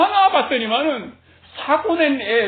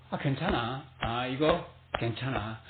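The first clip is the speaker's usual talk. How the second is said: 낮고 작은 목소리로 천천히 달래듯; 가슴을 토닥이듯 나직하게, 느리게; 자기를 다독임; 10 semitones lower, 11 dB quieter than usual. Retold or imitated. 숨막힌 자신을 다독이는 말. (숨막힌 나를 달래며, 나직이 다독이듯)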